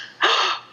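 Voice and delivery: high mock-shocked gasp; hammed-up sharp intake of breath; theatrical gasp. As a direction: gasp in pretend suspense after a cliffhanger. Mock suspense after blurb question.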